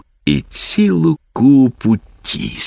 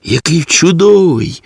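put-on higher-pitched, admiring girl's exclamation; delighted exclamation; 8 semitones higher than usual. A narrator's reading. Girl showing friends the puppy, exclaiming. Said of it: "вигукнула Гуніла".

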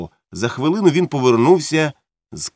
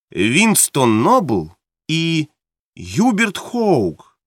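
each name drawn out very slowly, the last one pitched higher; slow comparing names; 5 semitones higher, much slower than usual. Acted. Mildred's friend weighs the candidates' names, slow and pointed. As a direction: lay the two names side by side, savouring them. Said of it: "Порівняйте".

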